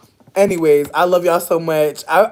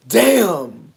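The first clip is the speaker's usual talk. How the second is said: usual speaking voice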